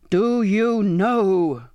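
loud, drawn-out furious roar. A narrator's reading. Captain cutting off the pleading girl, roaring. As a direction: roar each word out very slowly. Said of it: "he now roared".

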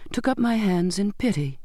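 ordinary delivery, nothing marked

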